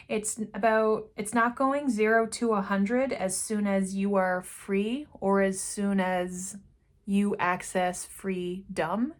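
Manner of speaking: usual speaking voice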